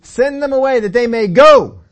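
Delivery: loud, high, pressing on send them away; emphasized dismissal. Shown as a demonstration. Preacher stressing the disciples' words about sending them away. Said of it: "I don't know if they put that emphasis on it".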